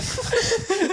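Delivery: high burst of laughter; loud high laugh. Real, not acted. Laughs at own joke.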